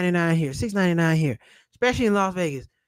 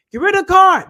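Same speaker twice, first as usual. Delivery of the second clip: shouted, higher, louder; loud command; 10 semitones higher, 10 dB louder than usual. Real, not acted. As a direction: bark the order at the viewer. Ranting about annual fees.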